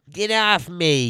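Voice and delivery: mocking nasal imitation, stretched slow; nasally odd voice. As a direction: draw it out in his odd nasal voice. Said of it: "he's a very nasally, like, odd voice".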